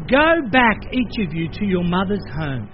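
usual speaking voice

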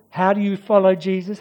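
normal talking voice

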